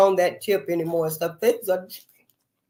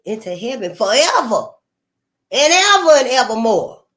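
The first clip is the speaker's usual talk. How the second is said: swelling louder into evermore; rising demand; 5 dB louder than usual. Retold or imitated. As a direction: build it up like a preacher's challenge. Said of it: "ask your higher power".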